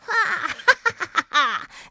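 high, loud, wicked laugh; one long ha breaking into staccato cackles; evil witch cackle. A narrator's reading. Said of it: "lachte sie böse".

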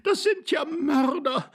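very high, drawn-out female wail; high, hysterical cry; horrified lady. A narrator's reading. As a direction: wail the accusation slowly. Lady cries murder.

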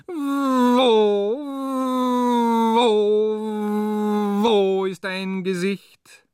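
high anguished slow outcry; anguished cry. Acted. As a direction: cry out in despair for a face.

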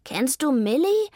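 a hint of mewing in the question. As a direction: coax the squirrel like a cat. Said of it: "maunzte nun Vivaldi".

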